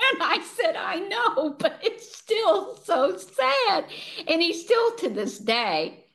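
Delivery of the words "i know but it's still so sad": high whine, stretching out the end; hammed-up, high-pitched sorrow; mock tearful protest. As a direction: imitate yourself weepy, amused by it. Retells her own tearful protest.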